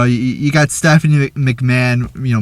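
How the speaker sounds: normal talking voice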